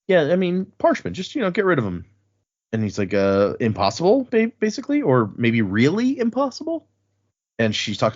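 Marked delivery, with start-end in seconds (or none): 0.1-2.0 higher, offhand retold suggestion; mock-casual, pitched up; feigned nonchalance. Retold casual pitch of drastic idea. 3.1-6.9 hesitant, climbing to a high question; lightly acted bewildered answer; mock incredulity. Retold baffled answer to absurd request.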